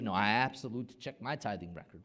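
usual speaking voice

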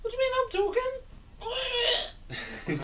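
piercing high pterodactyl shriek; pterodactyl impression. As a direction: screech it like a pterodactyl. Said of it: "It's my pterodactyl impression".

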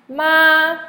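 high, level, loud "Ma"; first tone. Shown as a demonstration. Teacher running through four tones on one sound, "Ma" high and level. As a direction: hold "Ma" high and flat. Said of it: "Now, M A".

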